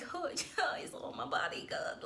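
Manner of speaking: whispered, half-laughing complaint; talking back to the coach in a whisper; whispered retort. Retold or imitated. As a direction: mutter it under your breath to the coach. Her unspoken reply to coach.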